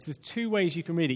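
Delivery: ordinary delivery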